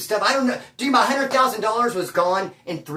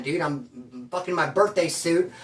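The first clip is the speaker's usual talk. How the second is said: normal talking voice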